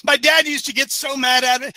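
ordinary talk

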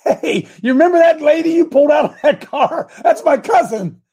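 high and loud, bursting with the news; excited greeting. Retold or imitated. Pastor imagines greeting Ritberger about his cousin, high and loud with excitement. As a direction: call out to him like you can't wait to tell him. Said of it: "I gotta go say something".

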